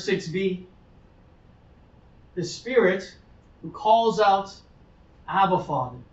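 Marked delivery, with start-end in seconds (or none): none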